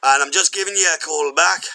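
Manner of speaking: high-pitched put-on Scottish accent. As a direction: play a Scottish caller phoning back, hesitant. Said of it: "I'll be Scottish for this one".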